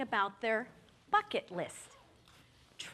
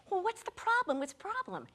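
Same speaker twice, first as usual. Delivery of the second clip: much higher, worried repeat; alarmed question; 11 semitones higher than usual. Retold or imitated. Ginger asking the officer, high alarmed question. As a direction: demand to know, rattled.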